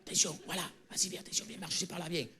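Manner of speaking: hushed urgent whisper; whispering while hiding. Acted. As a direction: whisper so nobody hears. Sneaking past the guard. (chuchotement caché)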